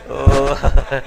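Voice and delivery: loud, bashful oh; shy woman. Retold or imitated. Lecturer imitates women caught overusing like, loud and bashful. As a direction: blush and gasp out oh. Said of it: "They used to blush and say".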